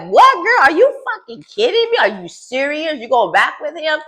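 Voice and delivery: acting out the friends' jaw-drop reaction; shocked disbelief. Recapping the show, voicing the friends high with mock shock. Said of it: "Marisol and Alexis like".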